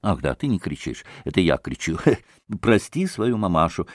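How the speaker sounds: ordinary delivery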